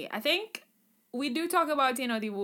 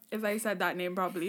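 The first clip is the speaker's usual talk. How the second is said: normal talking voice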